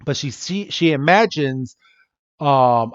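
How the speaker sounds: ordinary delivery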